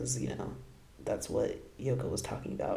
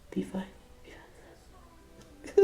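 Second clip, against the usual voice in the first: pleading whisper; 7 semitones higher, 12 dB quieter, much faster than usual. Retold or imitated. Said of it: "She's like".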